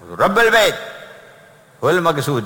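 high, loud cry of رب البيت; exclaimed answer. Real, not acted. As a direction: proclaim it with fervour. Climactic answer to congregation.

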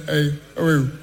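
low, calm mock Buhari; a hint of the calm old president. Buhari phoning the comedian, lightly voiced calm.

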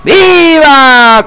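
high, long drawn-out cheers; stretched festive cries, falling at the end; festive viva shouts. Acted. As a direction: call out toasts like a fiesta announcer. Radio host calling out vivas.

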